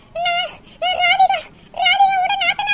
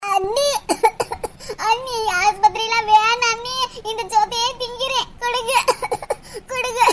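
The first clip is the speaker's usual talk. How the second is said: much lower, whiny begging voice; pleading sick daughter; 7 semitones lower than usual. Acted. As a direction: give in fearfully, begging her. Daughter begs sister-in-law.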